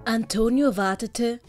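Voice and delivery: ordinary delivery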